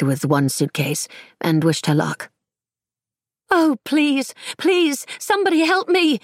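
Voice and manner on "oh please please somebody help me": put-on high, pleading young woman calling out; desperate cry for help. A narrator's reading. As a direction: beg anyone nearby for help, much higher. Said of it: "cried out to no one in particular".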